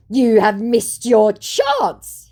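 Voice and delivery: slow, high, loud hammed-up threat; scammer's overblown ultimatum; mock threatening. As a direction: deliver a melodramatic warning. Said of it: "he threatens".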